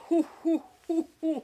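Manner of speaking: high, loud hoots; playful hooting. Pretend. Bird feeder cheering herself on, high playful hoots. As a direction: hoot high in short bursts.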